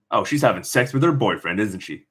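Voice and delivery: usual speaking voice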